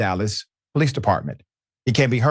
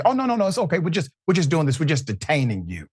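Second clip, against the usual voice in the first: lightly voiced cop, starting higher and fading back to normal; hint of a falsely soothing officer brushing it off; dismissive cop voice, only lightly put on; mock officer reassurance; 6 semitones higher than usual. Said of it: "tell you".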